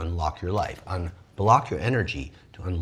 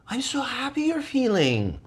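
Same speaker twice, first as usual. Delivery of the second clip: mock supportive voice; 14 semitones higher than usual.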